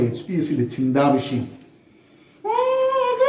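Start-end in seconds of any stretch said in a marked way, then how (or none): 2.4-3.3 very high, squeaky puppet voice; high-pitched kids' show puppet; Jikkumon. Acted. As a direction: play a tiny child character in a squeal. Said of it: "സുഹൃത്തിൻ്റെ പേര് ജിക്കുമോൻ എന്നാണ്".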